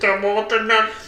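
high, slow, stilted voice; deadpan high character voice; odd musician character. Acted. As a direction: drag it out in a high put-on voice.

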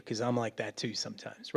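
hushed whispered confession. Real, not acted. Pastor admitting his own faults, whispered. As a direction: let it slip out as a soft aside.

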